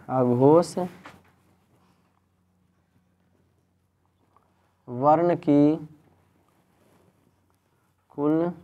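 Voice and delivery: word-by-word with long pauses; slow dictation. Real, not acted. Teacher dictates a note for students to write, slow dictation. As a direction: dictate slowly, leaving time to write.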